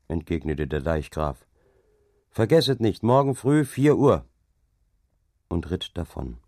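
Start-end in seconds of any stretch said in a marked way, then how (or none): 2.4-4.2 louder, higher call; called reminder. A narrator's reading. Dike reeve calls a reminder after the departing overseers, louder. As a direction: call it after them as they leave.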